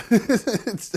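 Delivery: short laugh breaking in. Real, not acted. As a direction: laugh at the absurdity. Laughs mid-challenge.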